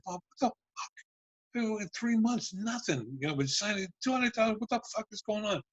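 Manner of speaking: retold indignant protest, voice pitched well up; exasperated confrontation. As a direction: re-enact fed-up disbelief at the producer. Retold confrontation with producer over spending.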